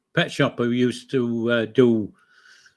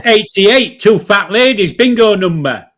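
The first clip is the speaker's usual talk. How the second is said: bingo caller call; 6 semitones higher, 7 dB louder than usual. Pretend.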